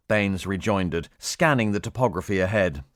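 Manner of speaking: ordinary delivery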